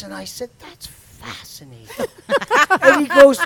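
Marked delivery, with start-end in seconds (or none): 0.6-1.9 whispered mock fascination, slow; sarcastic whisper. Driver mocks angry cop, dry whispered sarcasm. 1.9-2.8 high loud laugh bursting out; burst of laughter. Real, not acted. Telling friends the cop story, laughing out loud. Laughs after own retort.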